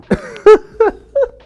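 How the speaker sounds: shrieking laugh; high loud burst of laughter. Real, not acted. Laughing at imagined viewer.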